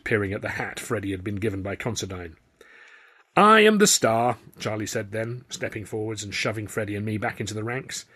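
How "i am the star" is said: high, loud and slow, every word planted; grandly proud Chaplin. A narrator's reading. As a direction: announce yourself as the star. Charlie's proud claim.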